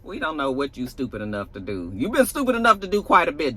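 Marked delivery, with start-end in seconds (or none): none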